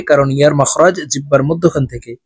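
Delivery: normal talking voice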